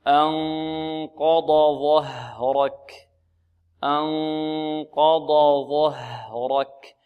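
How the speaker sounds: أنقض ظهرك very slowly, each letter full; careful tajweed recitation. Shown as a demonstration. Teacher modelling the verse for students, slow careful recitation. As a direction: stretch أنقض ظهرك with distinct heavy ض and ظ. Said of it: "لا نقول ظه".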